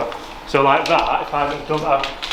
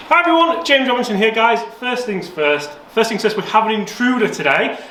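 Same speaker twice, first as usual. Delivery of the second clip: bright, much higher presenter intro; YouTube presenter; 7 semitones higher than usual. Acted. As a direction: greet the viewers like a host.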